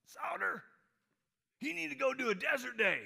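buddy's high, loud holler; very high, very loud shout; Slim's booming holler. Retold or imitated. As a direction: bellow the name way up high, like a rowdy pal. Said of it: "That's how he talks".

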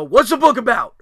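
high, loud demanding question; retold shout. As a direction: re-enact an exasperated dad yelling. Retold yelling during homework.

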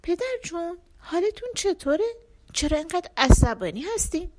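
sad. Acted. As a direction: ask her father gently, a small worried girl. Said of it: "با چهرهٔ غمگین پرسید".

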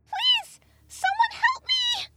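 piercingly high, loud, drawn-out plea; hysterical fairy. A narrator's reading. Trapped fairy begging anyone for rescue, hysterical. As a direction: beg for rescue in a tiny fairy shriek. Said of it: "The fairy's voice was hysterical".

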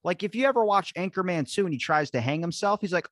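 ordinary delivery

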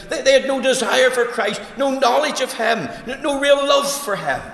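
loud, high, driving sermon voice; full-force preaching. Acted. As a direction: preach it hard at the congregation.

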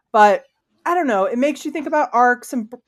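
talking through hiccups; words broken by hiccups. Real, not acted. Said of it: "I've got the hiccups".

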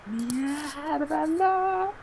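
high, soft cry of disbelief; shocked dismay. Retold or imitated. Reading the spoiler tweet, shocked high dismay. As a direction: squeak out the shock. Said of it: "Y yo".

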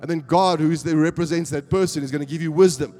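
loud, high, driving sermon voice; full-force preaching. Acted.

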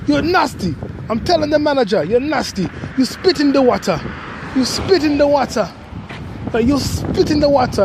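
put-on lightly done Jamaican accent, high and scolding; a hint of Jamaican, telling her off. Jamaican worker scolding a girl at the drink tray, lightly voiced Jamaican accent. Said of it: "this Jamaican dude was like".

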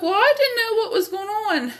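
stoned narrator answers her friend in a sheepish squeak; sheepish high excuse. Retold or imitated. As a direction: squeak out the excuse like a caught kid. Defending herself to Lauren, squeaky.

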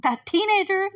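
put-on high, loud scornful scoff at the teenager; disgusted mutter. Joyce scoffing at the rude teenager, mock disgust. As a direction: sneer it out, a mocking jab. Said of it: "She's like".

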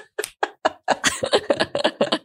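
breathless high laugh; a high, airy laugh at the memory. Real, not acted. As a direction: burst out in an unvoiced giggle.

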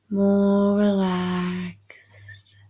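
slow breathy whisper. Acted. Whispered deepening suggestion.

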